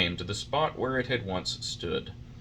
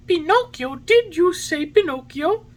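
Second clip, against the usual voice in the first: very high, louder puppet exclamation; startled excitement; 6 dB louder than usual. A narrator's reading. Pinocchio hears his own name from the pigeon, startled. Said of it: "jumping to his feet".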